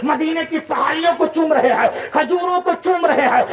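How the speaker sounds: rising, chanting sermon cadence; preaching fervour. Acted. Ecstatic preaching build-up. (जोश से बढ़ता हुआ वाज़)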